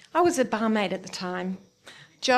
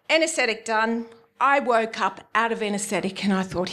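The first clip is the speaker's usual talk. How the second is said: usual speaking voice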